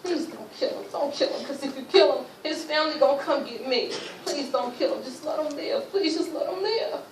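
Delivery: retold pleading. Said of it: "I'm begging them".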